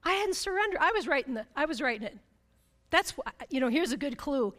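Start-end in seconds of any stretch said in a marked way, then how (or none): none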